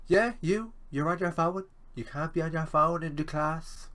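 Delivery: high, loud mocking teacher voice; exaggerated lecturer impression. Lecturer scolding a student for using his phone, in a high mocking voice. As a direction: ham up a scolding lecturer like he's talking to kids. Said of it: "He doesn't speak like that at all".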